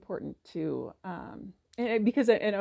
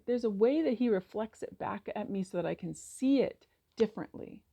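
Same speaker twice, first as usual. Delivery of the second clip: usual speaking voice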